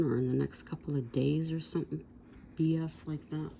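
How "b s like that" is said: usual speaking voice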